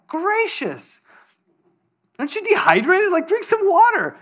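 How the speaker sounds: a lightly voiced "are you serious" at his buddy; mock exasperation. Retelling his thought about his thirsty friend, mock exasperated. Speaker's exasperated inner reaction.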